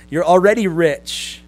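loud, slow, drawn-out mockery; sarcastic praise. Preacher as Paul mocking boastful believers, loudly sarcastic. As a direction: pour contempt on their pride, loudly and slowly. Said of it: "pours out some spiritual sarcasm".

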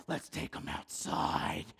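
slow, rough voiceless exclamation; mischievous cousin voice. Acted. Cousin proposing to take cars outside, mischievous voice. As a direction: draw it out in a forced, unpitched rasp. Cousin's gleeful suggestion, acted.

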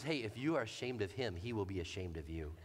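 lightly voiced mom lecturing her boy; stern mom voice.

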